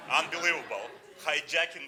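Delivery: amazed at the marketing job; marveling. Real, not acted. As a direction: burst out in admiration.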